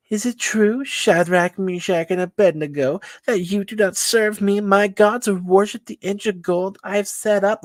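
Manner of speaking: high-pitched, loud king with a big ego; Nebuchadnezzar. Acted. Egotistical king questioning three men who won't bow, high-pitched and pompous. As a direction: puff it up in a high, imperious voice. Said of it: "like, a higher pitched voice".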